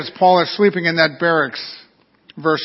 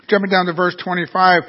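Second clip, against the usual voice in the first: ordinary talk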